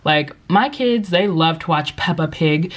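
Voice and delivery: ordinary talk, nothing marked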